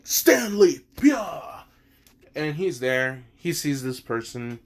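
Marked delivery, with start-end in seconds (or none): 0.0-1.6 loud, higher shouted Stand call; calling his Stand. Retold or imitated. Jojo summoning Star Platinum to save the girl, loud shout. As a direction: yell the Stand's name like a battle cry. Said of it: "Which makes him just like".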